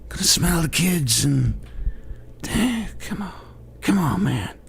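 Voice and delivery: put-on high, breathy old-man Biden voice; hammed-up Biden "come on, man"; Biden impression.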